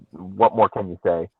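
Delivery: ordinary delivery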